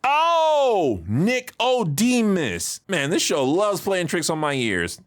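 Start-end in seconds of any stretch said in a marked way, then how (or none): 0.0-2.8 higher, slow drawn-out oh of understanding; dawning realization. Real, not acted. Reviewer finally catching the mumbled name, drawn-out realization. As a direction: let the penny drop slowly.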